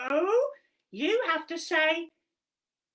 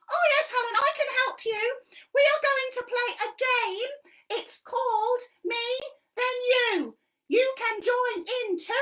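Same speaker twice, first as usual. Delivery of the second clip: high, loud cheeky monkey voice; squeaky puppet monkey helping Helen; 11 semitones higher, 6 dB louder than usual. Acted. Monkey puppet explaining a game to Helen, high and bouncy.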